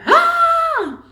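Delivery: high, loud, long scream of pain; labour scream. Retold or imitated. Mother in labour screams as the baby comes, full loud scream. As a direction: let out a full panicked scream. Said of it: "I really am screaming".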